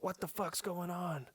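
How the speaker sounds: high, slow, soft mock outrage; imitated confrontation. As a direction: put on a high voice for an upset stranger. Angry fiance confronts him, retold.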